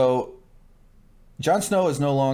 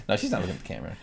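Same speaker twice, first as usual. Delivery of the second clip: usual speaking voice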